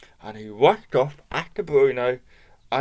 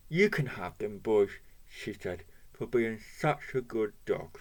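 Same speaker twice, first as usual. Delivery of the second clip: soft lower gentle voice; kind; 4 semitones lower, 7 dB quieter than usual. Acted. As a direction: give them away kindly. Old lady gives sausages kindly.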